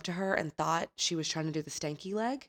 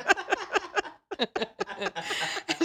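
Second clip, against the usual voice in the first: big laugh; 14 semitones higher than usual. Real, not acted. Host laughing at friend's taxi-in-underwear story. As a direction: dissolve into laughs.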